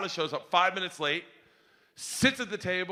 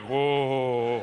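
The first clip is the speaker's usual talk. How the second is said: low, drawn-out whoa; groggy whoa; 6 semitones lower than usual. Acted. Hungover parent getting in the car, groggy whoa. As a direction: let out a long, low whoa. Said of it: "you're like".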